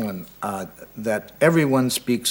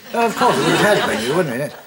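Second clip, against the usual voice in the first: put-on higher, louder English accent with a laugh; 5 semitones higher, 5 dB louder than usual. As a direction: Englishman catching on, amused. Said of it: "I don't do a good English accent".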